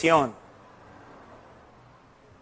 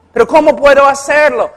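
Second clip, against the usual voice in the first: desperate woman; 6 semitones higher, 5 dB louder, faster than usual. Retold or imitated. Distressed woman asking pastor.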